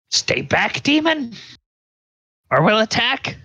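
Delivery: high, squeaky voiced women warding off a demon; threatening witches. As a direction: pipe it up high as a frightened threat. Game master voices three threatening women.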